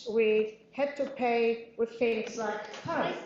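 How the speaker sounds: ordinary delivery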